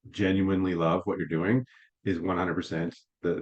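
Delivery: ordinary talk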